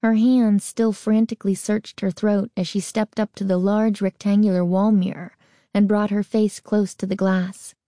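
ordinary delivery, nothing marked

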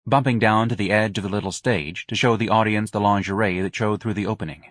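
ordinary delivery, nothing marked

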